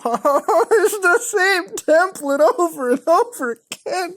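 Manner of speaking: laughing high-pitched through the words. Real, not acted. Laughing at a repeated scam template.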